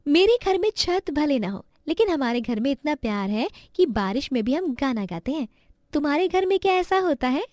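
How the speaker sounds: put-on high-pitched child voice; proud little girl. Chhavi telling classmates about her loving family, proud.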